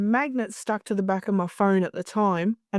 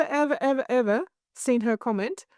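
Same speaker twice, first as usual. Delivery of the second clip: ordinary delivery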